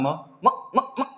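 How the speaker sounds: म म म said short and quick with a laugh; quick clipped m. Shown as a demonstration. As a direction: clip म fast with no vowel. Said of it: "इस तरह जल्दी से बोलेंगे".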